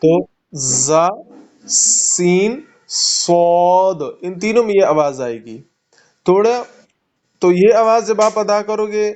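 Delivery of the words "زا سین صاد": زا، سین، صاد drawn out with a whistle; slow whistling letter demo; whistled sibilants. Shown as a demonstration. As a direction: stretch زا، سین، صاد, teeth together, whistling. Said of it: "یہ سیٹی کی آواز ہوتی ہے".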